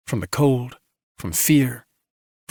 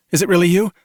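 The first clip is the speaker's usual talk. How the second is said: higher, louder question cutting in; interrupting; 7 semitones higher, 5 dB louder, faster than usual. A narrator's reading. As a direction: break in with an urgent question. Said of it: "Red interrupted".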